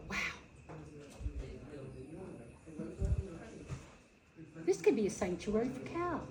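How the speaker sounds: soft, quiet awe; quiet retold wonder at the misty pasture; hushed wonder. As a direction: marvel at it softly. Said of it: "And I said".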